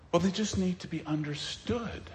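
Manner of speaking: put-on gentle understanding, barely voiced; mock sympathetic. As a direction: imitate a soft-hearted excuser, very quietly. Mimics sympathizers with rebellious children.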